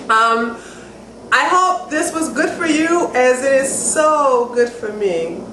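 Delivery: ordinary talk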